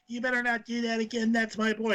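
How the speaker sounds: indignant mother. Retold or imitated. Retold mother warning wrestlers off her boy, high mock scold. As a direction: squeak it like a protective mom.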